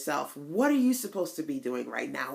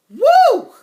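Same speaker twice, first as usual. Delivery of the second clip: very high, loud whoop; joyful woo; 15 dB louder than usual. Real, not acted. Remembering the sister talk, joyful whoop. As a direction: let out a whoop of joy. Recalling the powerful event.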